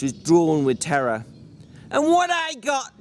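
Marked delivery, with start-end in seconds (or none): 1.9-3.0 furious George. Acted.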